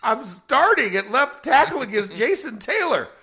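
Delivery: high, loud, comic indignation; freaking out. Retold or imitated. Starter denied his warm-up reps, freaking out. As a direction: protest in mock panic, close to laughing. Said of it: "I'm, like, freaking out".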